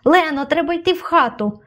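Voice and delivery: storyteller's contained shout; narrated cry. A narrator's reading. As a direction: call out urgently, volume held back. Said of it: "крикнув я".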